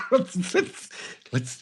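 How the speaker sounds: high, giggly cartoon king; cartoon king trailing off; high giggling monarch; King Candy voice. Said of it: "as King Candy".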